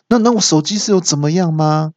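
high-pitched mock exasperation; flustered anger. Phone owner demanding news of her phone, mock exasperation. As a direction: imitate a woman flustered and cross. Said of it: "气急败坏的说".

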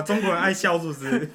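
ordinary delivery

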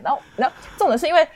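ordinary talk, nothing marked

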